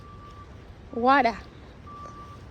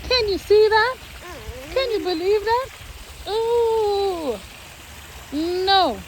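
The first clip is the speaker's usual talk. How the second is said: excited; 5 semitones higher, slower than usual. Real, not acted. Excited at view with baby.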